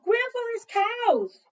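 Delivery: "Grandfather's cows!" high, loud and slow; alarmed cry. Acted. Child remembering grandfather's cows, a high loud cry.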